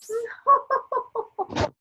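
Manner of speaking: a short high laugh at a joke; light social laugh. Acted. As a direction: laugh politely, amused. Guest laughs at the witticism.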